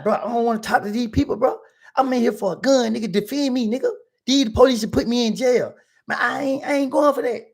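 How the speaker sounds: high-pitched, heated refusal; getting loud. Retold or imitated. Client refusing his lawyer's advice, loud and heated. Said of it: "we getting loud".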